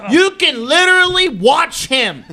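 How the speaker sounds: high, loud, stretched-out words; mock exasperated shout. Host pleading with Liv over the show, loud mock protest. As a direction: yell each word in playful protest. Mock outcry to absent friend's partner.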